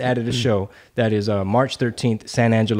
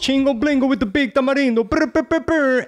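hype host voice; 12 semitones higher than usual. Acted.

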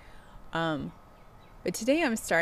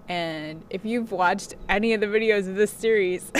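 usual speaking voice

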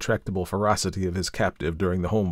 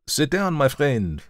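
a lightly raised, slow calm welcome; Dupin, lightly voiced; 8 semitones higher, 3 dB louder, slower than usual. A narrator's reading. Dupin greets the visiting sailor.

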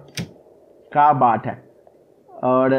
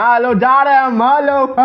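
teasing sing-song hello; 9 semitones higher, 4 dB louder than usual. Real, not acted. Player taunting the granny on his way out, high teasing hello. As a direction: cheekily wave goodbye as you run past her.

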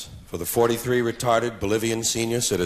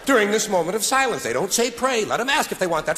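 ordinary talk, nothing marked

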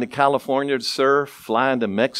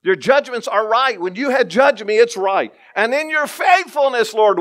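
raised, fervent psalm reading; prayerful scripture reading; 10 semitones higher than usual. Acted. Preacher praying the psalm to the congregation, fervent. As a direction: pray the verse aloud with fervor.

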